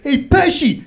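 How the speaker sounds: mock taunt. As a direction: holler the nickname to needle him. Schoolmates taunting him by nickname.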